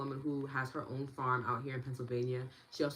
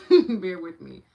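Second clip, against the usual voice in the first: laughing; 5 semitones higher, 16 dB louder, slower than usual. Real, not acted. Laughing while taking notes.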